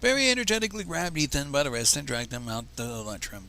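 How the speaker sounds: ordinary delivery, nothing marked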